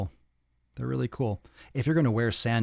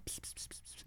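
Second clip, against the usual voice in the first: unvoiced pss-pss cat call; 23 dB quieter than usual. Calling a cat over to listeners, soft unvoiced pss. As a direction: hiss softly like calling a kitty over. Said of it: "If you go, you know".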